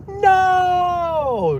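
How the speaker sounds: high desperate yell of protest; loud high despairing no; anguished shout. Retold or imitated. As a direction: cry out as if hearing you'll be transferred again. Stuck on hold again.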